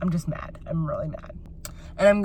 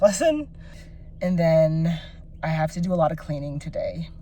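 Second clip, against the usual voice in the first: normal talking voice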